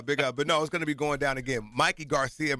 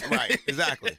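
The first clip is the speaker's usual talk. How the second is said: laughing; 5 semitones higher, 4 dB louder than usual. Real, not acted. Laughing banter.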